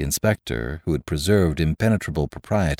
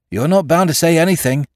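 much higher and louder, busybody; officious excitement; 11 semitones higher, 5 dB louder than usual. A narrator's reading. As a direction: butt in eagerly. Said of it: "in officious excitement".

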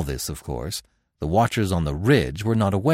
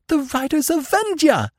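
much higher, triumphant cry; exultant cry. Acted. Devi spotting her army in the valley, exultant high cry. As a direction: burst out with joy at the sight. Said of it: "she cried exultantly".

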